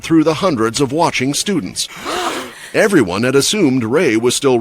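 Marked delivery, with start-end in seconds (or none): 1.9-2.7 sharp gasping; crowd gasp. A narrator's reading. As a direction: gasp in shock. Crowd gasps.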